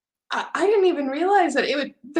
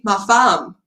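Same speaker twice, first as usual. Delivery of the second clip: French host dad; 3 semitones lower than usual. Retold or imitated. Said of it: "he was saying".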